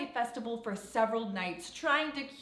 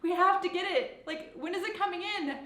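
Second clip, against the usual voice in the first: higher, eager and excited; 8 semitones higher, faster than usual. Retold or imitated. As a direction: gush like a thrilled fan. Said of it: "I was like".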